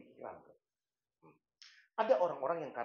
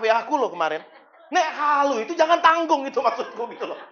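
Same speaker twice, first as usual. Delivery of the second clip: loud, high, driving sermon delivery; fiery pulpit preaching; full-force preaching; 11 semitones higher, 12 dB louder than usual. Acted. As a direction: preach it out to the whole congregation.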